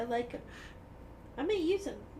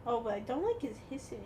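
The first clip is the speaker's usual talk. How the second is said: normal talking voice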